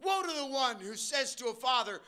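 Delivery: ordinary delivery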